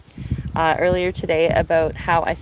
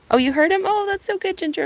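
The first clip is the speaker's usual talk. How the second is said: delighted; 12 semitones higher, faster than usual. Real, not acted. Delighted a viewer heard the bird.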